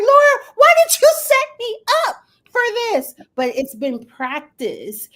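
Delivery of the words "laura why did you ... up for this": high, loud mock wail; mock crying. Set up on live TV, high mock cry to Laura. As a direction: whine it like a betrayed friend. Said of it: "inside I'm crying like".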